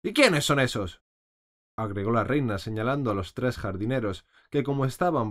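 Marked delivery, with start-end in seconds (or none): 0.0-1.0 imperious queen. A narrator's reading. Queen demands about gardeners.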